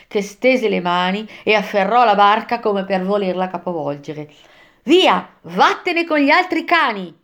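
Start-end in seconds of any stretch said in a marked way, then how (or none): 4.8-7.1 high cry driving someone off; shouted dismissal. A narrator's reading. Virgilio shouting the spirit away from the boat, yelled order. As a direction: drive him away with a raised-pitch yell. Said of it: "gli gridò Virgilio".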